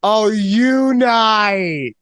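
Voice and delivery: long drawn-out, very slow "oh"; dawning realization. Retold or imitated. Finally reading the screen right, slow dawning realization. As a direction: stretch each word as it finally clicks.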